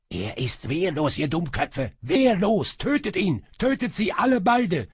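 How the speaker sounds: climbing to a high, loud scream; frantic shouted orders. A narrator's reading. Sorcerer urges his slaves to kill both, frantic shouting. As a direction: escalate from urgency to shrieked command.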